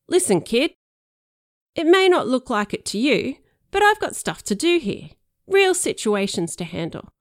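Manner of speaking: loud, stern cop right in his face. Pretend. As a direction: lean in and lay down the law. Cop gives stink eye.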